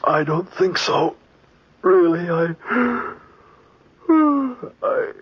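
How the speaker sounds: on the edge of tears; near tears. Acted. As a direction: voice breaking with fatigue. Breaking down, cry heard.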